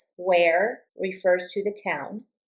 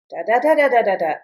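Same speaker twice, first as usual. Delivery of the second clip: "da da da" rattled fast and loud, arching then falling; falling intonation pattern; 2 semitones higher, 6 dB louder, much faster than usual. Shown as a demonstration. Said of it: "If the question goes da da da".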